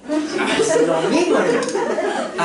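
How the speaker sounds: slow amused laugh through the words; laughing at how close the two sound. Real, not acted. Preacher laughing to the congregation at his example.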